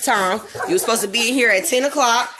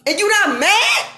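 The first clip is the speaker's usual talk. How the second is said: furious shout; 6 semitones higher, slower than usual. Real, not acted. Ranting to viewers about racism, furious shout.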